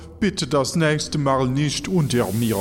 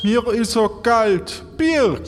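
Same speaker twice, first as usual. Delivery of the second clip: whiny sick call; 7 semitones higher, slower than usual. Acted. Sick man calls weakly.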